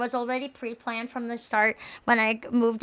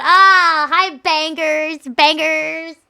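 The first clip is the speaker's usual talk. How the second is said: excited greeting; 4 semitones higher, 7 dB louder, much slower than usual. Pretend.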